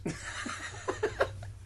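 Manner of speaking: wheezing high laugh; high breathless laugh. Real, not acted. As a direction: squeal of laughter. Laughing at the joke.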